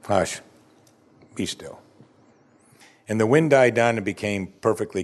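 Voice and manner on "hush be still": low, effortless hush, trailing off softly; unenthusiastic command. Acted. Preacher shows how Jesus calmed the wind, understated. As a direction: command it without trying. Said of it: "didn't quite say it with much enthusiasm".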